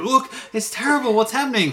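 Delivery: high-pitched, hammed-up alarm; mock panic. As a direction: imitate someone panicking over a disaster. Mimics panicked reaction to hurricane photos.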